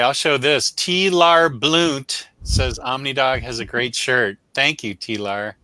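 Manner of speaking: usual speaking voice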